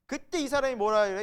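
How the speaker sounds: usual speaking voice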